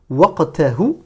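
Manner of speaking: "Waqtahu" with a back-of-throat q; careful emphatic q closing the first syllable of "Waqtahu"; emphatic qaf. Shown as a demonstration. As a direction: stop on the uvular q in "Waqtahu". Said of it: "Prononcez bien le qaf comme un qaf emphatique".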